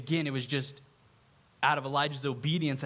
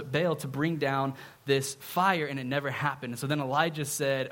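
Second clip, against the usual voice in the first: ordinary talk, nothing marked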